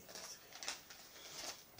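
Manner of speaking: barely voiced, slow whisper. Real, not acted. Repeats, whispered.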